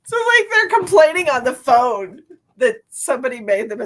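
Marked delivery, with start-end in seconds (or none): none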